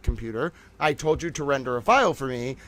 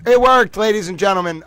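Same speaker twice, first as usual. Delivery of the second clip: high, loud burst of triumph; excited cheer; 5 semitones higher, 6 dB louder than usual. Real, not acted. Streamer to her viewers after the upload succeeds, triumphant cheer. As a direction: shout it out, relieved it finally worked.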